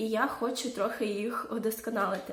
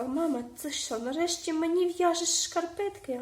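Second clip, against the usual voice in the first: lightly voiced child, higher pitch; imitated child; 7 semitones higher than usual. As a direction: quote a child asking mom, pitched up. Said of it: "Дитина моя сказала". (злегка зображений дитячий голос, вище)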